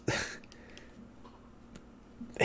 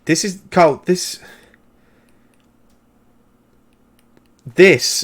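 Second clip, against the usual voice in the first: higher pitch, halting between words; exasperated, broken-off starts; upset, faltering; 5 semitones higher, slower than usual. Acted. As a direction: struggle to get the words out, voice raised in pitch. Wenda upset, struggling for words at Carl.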